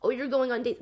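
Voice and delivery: exaggerated nagging jab; mock nagging. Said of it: "nagging me about".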